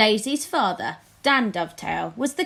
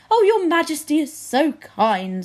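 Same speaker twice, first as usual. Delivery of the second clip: flattering courtier; 6 semitones higher, 5 dB louder than usual. Acted.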